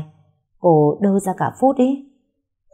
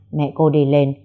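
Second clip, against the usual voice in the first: ordinary delivery, nothing marked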